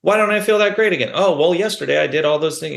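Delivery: imitating his own puzzled past self; mock self-complaint. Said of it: "I'll be like".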